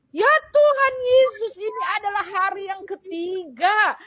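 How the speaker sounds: high, loud, slow exclamation easing gradually; fervent cry to God. Retold or imitated. Patient realizing it is the promised third day, fervent cry. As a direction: cry out in awe as the promise comes back.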